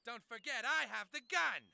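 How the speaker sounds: high, loud warning to the crowd; power-mad high-pitched menace; tyrant's threat. Acted.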